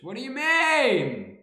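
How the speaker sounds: high, loud, drawn-out protest; indignant disbelief. Real, not acted. Teased about sounding Australian, indignant. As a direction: stretch it out in loud mock outrage.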